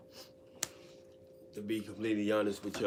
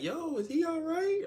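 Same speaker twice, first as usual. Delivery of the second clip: much higher, squeaky imitation of a woman; mock girly voice; 6 dB louder than usual. Teller imitating how most women react to his cat, high mock coo.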